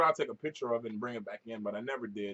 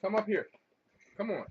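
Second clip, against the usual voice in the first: usual speaking voice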